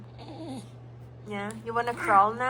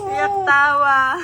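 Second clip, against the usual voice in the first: loud, slow, drawn-out tease; loud teasing; 2 semitones higher, 11 dB louder, slower than usual. Real, not acted. Mother teasing baby who cries and laughs, loud and drawn out.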